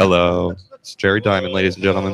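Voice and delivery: low mock showman intro; announcer voice.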